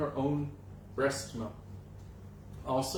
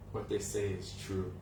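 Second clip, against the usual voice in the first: put-on much lower, quiet, solemn older brother; Balaram's grave reply; 8 semitones lower, 7 dB quieter than usual. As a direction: drop the voice low and confirm it gravely. Balaram confirms the boys' taunt.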